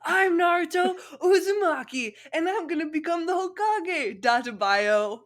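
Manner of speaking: high loud boast from a tabletop; loud high proud drunk proclamation; drunk declaration. Acted. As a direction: announce it to the whole party. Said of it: "jumped on a table, making a huge noise".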